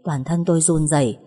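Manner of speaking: ordinary delivery, nothing marked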